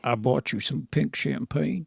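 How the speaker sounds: low, soft villain voice; quiet, deep movie-villain impression; creepy villain quote. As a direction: say it low and hushed, like a stalker. Continues quoting the movie villain.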